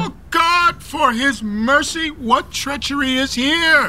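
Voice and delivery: high, appalled cry to heaven; horrified outcry. Acted. Father aghast at his son's treachery, high and shaken. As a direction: cry out at the betrayal.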